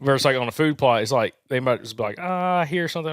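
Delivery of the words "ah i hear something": imagined wary deer. Pretend. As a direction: lightly voice a deer noticing a noise. Voices a wary deer's thoughts.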